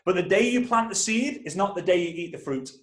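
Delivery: ordinary talk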